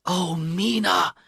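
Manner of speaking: soft, hushed anguished cry; silent cry. A narrator's reading. Husband fearing for his wife, hushed anguished cry. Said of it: "I cried silently".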